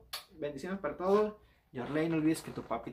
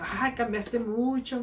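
drawn-out, higher-pitched exclamation; imitated ex-girlfriend; 7 semitones higher, 3 dB louder, much slower than usual. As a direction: imitate her slow, high-voiced remark. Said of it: "Y me dijo".

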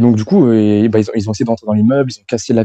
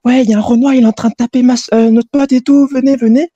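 put-on much higher and louder, frantic girls' voice; calling for help; 4 dB louder than usual. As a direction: yell for backup like panicked friends. Les copines appellent des mecs. (voix aiguë et forte des copines qui appellent à l'aide)

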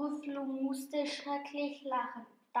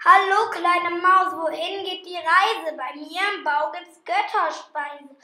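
loud, higher, sweet-talking fox voice; sly fox; 5 semitones higher, 11 dB louder than usual. Acted. Fox luring the mouse into his den, loud and sly. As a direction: lure the mouse in like a cunning fox.

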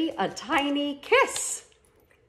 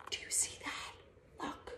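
hushed whisper; 5 semitones higher, 19 dB quieter than usual. Acted. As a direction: gasp then breathy whisper. Gasp, hushed wonder.

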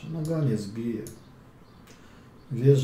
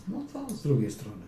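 ordinary talk, nothing marked

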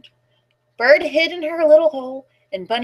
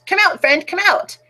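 mock shout; 6 semitones higher than usual. A narrator's reading. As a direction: call out lightly to someone hiding. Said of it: "Mouse starts to shout".

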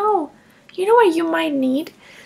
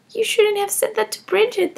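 ordinary talk

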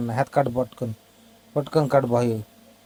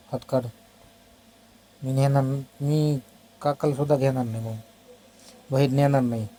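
usual speaking voice

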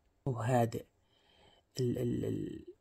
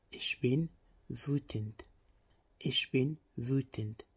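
each syllable drawn out slowly for learners; careful dictation pace; slow teaching demo; much slower than usual. Shown as a demonstration. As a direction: stretch Ich bin wütend out very slowly. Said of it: "Wütend يعني غاضب".

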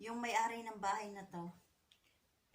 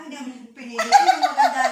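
laughing; 3 semitones higher, 18 dB louder than usual. Real, not acted. Laughing while telling.

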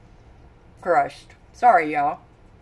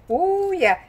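delighted; 11 semitones higher than usual. Real, not acted. Dipping crust in chocolate.

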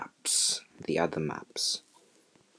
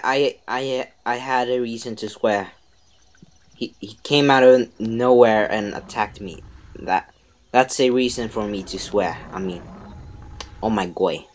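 ordinary delivery

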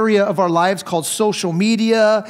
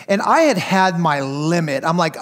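ordinary talk, nothing marked

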